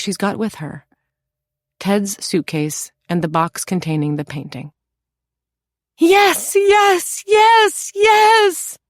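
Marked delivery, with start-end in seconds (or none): 6.0-8.8 very high, loud rising yells of relief; ecstatic exclaiming. A narrator's reading. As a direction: burst out in relief, building. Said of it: "Louisa exclaims".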